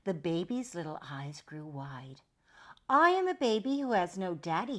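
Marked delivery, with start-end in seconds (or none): 2.9-4.8 put-on high, loud baby voice. A narrator's reading. Baby realising he has no daddy, high loud voice. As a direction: announce it high like a small child. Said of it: "he said".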